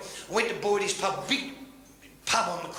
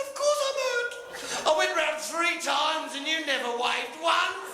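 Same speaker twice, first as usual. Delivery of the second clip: high, slow indignant complaint; hurt friend complains, pitched up; indignant punchline; 7 semitones higher, slower than usual. Acted.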